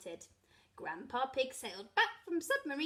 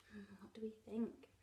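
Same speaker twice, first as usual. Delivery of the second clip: whispering; 3 semitones lower, 11 dB quieter than usual. Real, not acted. Aside to child listener.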